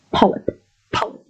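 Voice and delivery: "polyp" whispered-light, P from the lips only; light p pronunciation. Shown as a demonstration. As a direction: say "polyp" with an airy lip P. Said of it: "But p is actually just from your lips".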